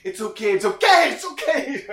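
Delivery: high, loud, panicky it's okay; frantic reassurance. Retold or imitated. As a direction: soothe him in a rising panic. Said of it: "You're like".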